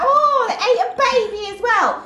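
high, loud mocking snob; hammed-up hoity-toity whine; hoity-toity watchman. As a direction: mimic a pompous guard tattling in a silly high voice. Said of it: "I'm a hoity-toity watchman".

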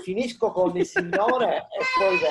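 più alto e forte, paziente; richiamo al bambino. Real, not acted. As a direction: rispondi al figlio che insiste. Padre risponde al figlio. (padre al figlio che lo chiama, voce alta e paziente)

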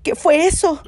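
startled duck. Acted. Pata hearing scratches on the roof, startled. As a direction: jump at the noise. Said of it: "preguntó Pata".

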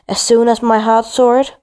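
plain reading voice

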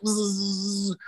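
high imitated insect buzzing; steady nagging "zzz" noise; pestering buzz. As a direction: buzz like a pest that won't go away. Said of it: "I was like that pestering person".

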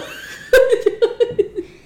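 long, high, loud laughter; hearty laugh. Real, not acted. Player laughs at azul colorido, hearty. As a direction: crack up at your own joke.

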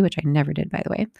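usual speaking voice

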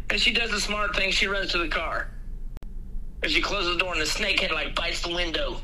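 ordinary talk

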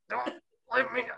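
high, odd alien grumble; grumpy alien. Retold or imitated. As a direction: put on a grumpy little alien, pitched high. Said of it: "a grumpy alien who's like".

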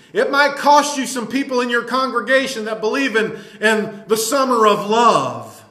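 fired-up pulpit preaching, hard stresses; indignant sermon delivery; forceful preaching. Real, not acted. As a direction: preach it with insistent force, punching key words. Preaches against weakling preachers.

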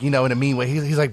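ordinary delivery

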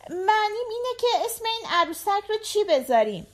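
put-on high, quick little-girl voice; a young girl eagerly explaining to her dad, pitched high; little daughter. A narrator's reading. Narrator voices the daughter asking. (صدای زیر و تند دختربچه)

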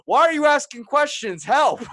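high, loud panicked yell; panicked shout. Retold or imitated. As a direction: yell for help in a frantic panic. Panicked plea to cousin.

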